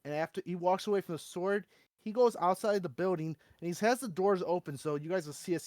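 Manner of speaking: ordinary talk, nothing marked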